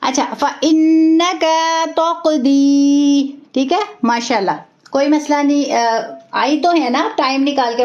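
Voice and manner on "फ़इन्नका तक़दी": slow recitation with held ghunna; recitation demo. Shown as a demonstration. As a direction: draw out फ़इन्नका with a nasal hold on नन्न. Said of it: "यहाँ हमने गुन्ना का ख्याल करना है". (फ़इन्नका तक़दी धीमी तिलावत, गुन्ना के साथ)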